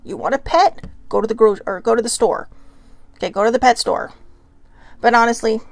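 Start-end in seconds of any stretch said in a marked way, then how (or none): none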